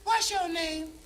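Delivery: high, mock-girlish sass; hammed-up high sassy woman. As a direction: talk back like she owns the beach. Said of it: "She said".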